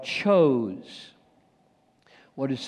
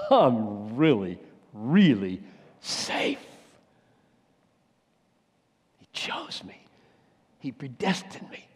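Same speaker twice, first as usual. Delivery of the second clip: awed inner assurance; 4 semitones lower than usual. Pretend. Believer's inner assurance welling up.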